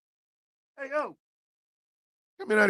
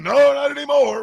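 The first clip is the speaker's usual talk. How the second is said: higher, louder mock cat refusal; voicing the cat; 7 semitones higher, 5 dB louder than usual. Owner voicing the stubborn cat, mock defiant. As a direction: play the cat saying no defiantly. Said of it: "He over here talking about".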